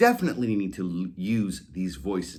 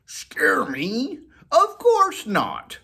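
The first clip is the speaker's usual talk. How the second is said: scoffing dog voice; 14 semitones higher, 6 dB louder, much slower than usual. Acted. Molly the collie scoffing at the cat, whisper to loud. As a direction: whisper rising to a high, slow, loud scoff.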